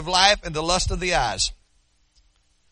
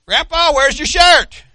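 put-on higher, louder and slower, a child asking; granddaughter's voice; 8 semitones higher, 8 dB louder, slower than usual. Grandfather imagines granddaughter asking about his shirt, childlike. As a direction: play the startled little granddaughter. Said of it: "I thought".

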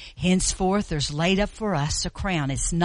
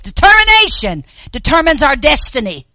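preaching; 8 semitones higher, 11 dB louder than usual. Real, not acted. Preacher declaring theme loudly.

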